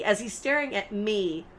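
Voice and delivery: ordinary delivery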